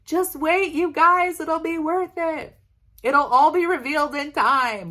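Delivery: high, bright teasing voice; playful high "just wait" to followers; teasing sing-song. Retold or imitated. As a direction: pitch way up and tease a secret. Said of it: "I'm like".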